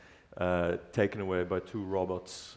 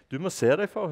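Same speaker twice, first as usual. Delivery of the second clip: higher, louder scolding butler; 10 semitones higher, 7 dB louder, much faster than usual. Acted. Butler scolds the robot vacuum, loud scolding. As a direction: tell him off sharply. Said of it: "hørte han Bulter kjefte".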